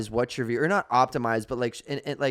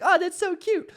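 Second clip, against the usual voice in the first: cutesy squeal. Pretend. Voicing a viewer's reaction.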